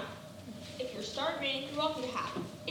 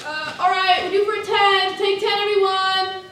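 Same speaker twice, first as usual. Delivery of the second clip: loud call to the whole room; 6 semitones higher, 14 dB louder than usual. Real, not acted. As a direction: announce the break across the stage. Calling a break to the cast.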